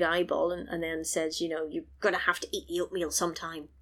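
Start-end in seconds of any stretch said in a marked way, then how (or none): 1.7-3.7 low, quiet English villain threat; menacing English accent, hushed and quick. Retold or imitated. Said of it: "In an English accent".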